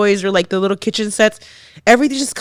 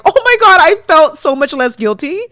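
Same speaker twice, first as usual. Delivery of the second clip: relieved; 7 semitones higher, 5 dB louder than usual. Retold or imitated. Retelling her relief hearing moms' stories.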